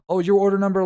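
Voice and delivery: lightly voiced cashier; high cashier voice. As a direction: mimic the cashier. Worker answering customer.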